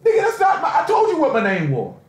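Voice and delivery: acted indignant outburst, starting high; indignant protest. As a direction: protest the wrong name, high then dropping. Acting out protest at wrong name.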